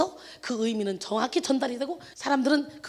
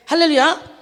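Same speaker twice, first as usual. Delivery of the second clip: loud, high hallelujah call to the church; preaching hallelujah; 4 semitones higher, 8 dB louder than usual. Acted. Preacher rousing the congregation, loud hallelujah.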